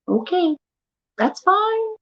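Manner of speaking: high, not-really-fine reply; feigned acceptance. Retold or imitated. Wife accepting husband's apology, mock huffy.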